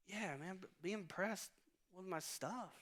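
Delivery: high, soft pleading whine; whiny plea. Retold or imitated. Grandson to grandpa wanting praise, high soft mock whine. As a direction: beg him to be impressed, mock-whiny. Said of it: "I'm like".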